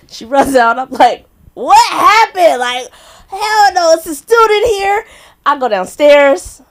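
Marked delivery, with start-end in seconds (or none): 1.5-5.0 high, loud, drawn-out outrage; alarmed yell. Retold or imitated. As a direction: re-enact a shocked, indignant shout. Acts out her alarmed reaction.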